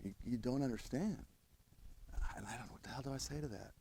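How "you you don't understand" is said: normal talking voice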